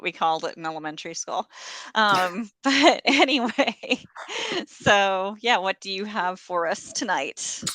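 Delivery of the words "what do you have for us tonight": ordinary delivery, nothing marked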